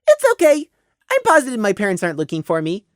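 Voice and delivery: high, quick little voice; breezy child. Acted. Prey reassuring the worried mare, breezy child voice. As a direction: brush it off like a carefree kid.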